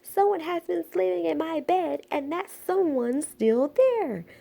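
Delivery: high squeal, slowing at the end. A narrator's reading. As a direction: squeal it like an excited little cub. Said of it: "Baby Bear squealed".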